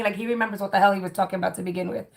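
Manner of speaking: normal talking voice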